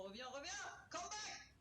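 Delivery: high shout of come back; calling after it. Real, not acted. Player yelling at a fleeing game enemy, high call. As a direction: yell after the escaping target.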